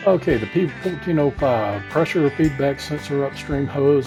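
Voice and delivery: ordinary delivery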